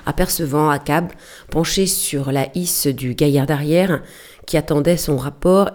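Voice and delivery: ordinary delivery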